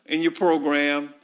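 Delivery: normal talking voice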